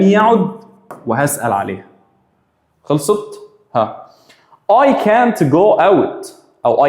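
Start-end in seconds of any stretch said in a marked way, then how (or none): 4.7-6.3 slow model sentence. Shown as a demonstration. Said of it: "teacher models example sentence".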